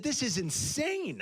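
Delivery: slow high astonished; amazed disbelief. Retold or imitated. Reacting to peanut butter pizza, slow high amazement. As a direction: stretch out "insane" in wonder. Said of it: "I said".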